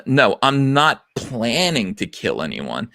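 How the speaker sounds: slow, stress on not, rising at the end; "not" pushed, slow mocking read; chosen emphasis. Shown as a demonstration. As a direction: drag it out and lean on "not". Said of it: "I'm gonna read it with whatever emphasis I choose".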